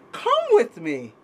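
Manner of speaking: plain reading voice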